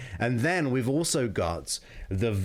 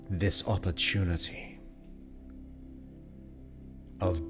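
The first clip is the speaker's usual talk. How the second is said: slow, soft, mesmerizing hypnosis voice; hypnotic guided relaxation; 4 semitones lower, 6 dB quieter than usual. Acted. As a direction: lull them under, slowly, with long pauses. Hypnosis session begins.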